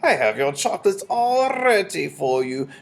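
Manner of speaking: high, slow, syrupy witch; cooing. A narrator's reading. Grand High Witch invites Bruno up, cooing slowly. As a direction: drip false sweetness, drawing words out. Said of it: "cooed the Grand High Witch".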